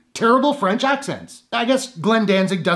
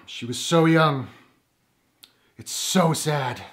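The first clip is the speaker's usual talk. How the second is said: lower, slow mock-mournful detectives; deadpan detective; 6 semitones lower, 4 dB quieter, slower than usual. Mocking movie detectives, deadpan and lower. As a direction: drone it out with no feeling at all. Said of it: "detectives are standing around going".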